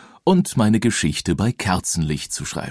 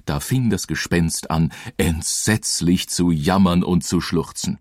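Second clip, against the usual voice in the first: usual speaking voice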